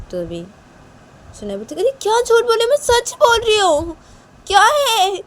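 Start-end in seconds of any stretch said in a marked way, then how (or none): 1.8-5.2 very high, loud protesting voice; indignant little girl. Acted. Shanaya denies lying to father, loud and indignant. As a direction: protest shrilly that you are truthful. Acted child protests accusation.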